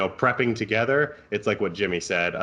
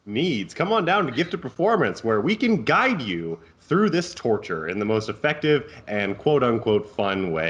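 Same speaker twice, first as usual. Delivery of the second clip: mock ad read; 4 semitones higher than usual. Mock ad plug for their business.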